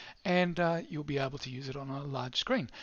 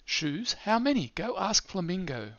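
high and louder on "How many?"; animated storybook voice; 5 semitones higher, 5 dB louder, slower than usual. Shown as a demonstration. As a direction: pitch the question way up in surprise. Character line read for app.

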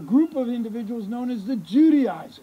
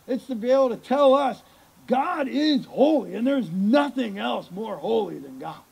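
normal talking voice

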